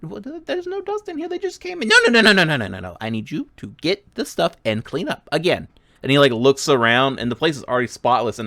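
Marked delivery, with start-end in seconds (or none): 0.0-1.9 put-on much higher, softer kid reply; child protesting. Apprentice answers back. 1.9-5.7 louder emphatic no's; exasperated gnome. Acted. Gnome refuses apprentice.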